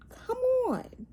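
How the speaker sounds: high-pitched exasperated come on. Real, not acted. Vlogger scolding the channel, high exasperated plea. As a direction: throw it up high in disbelief.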